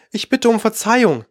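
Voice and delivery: high, meek apology; very modest. A narrator's reading. Alice apologising to the mouse, modest. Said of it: "sagte Alice sehr bescheiden".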